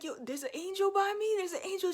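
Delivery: high, excited retold question; amazed questioning. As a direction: quiz a small child in acted astonishment. Retold excited questioning of young niece.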